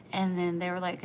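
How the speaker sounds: normal talking voice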